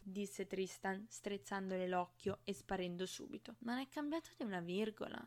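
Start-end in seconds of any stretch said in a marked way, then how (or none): none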